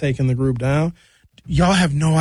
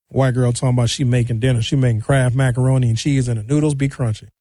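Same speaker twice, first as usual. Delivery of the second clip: usual speaking voice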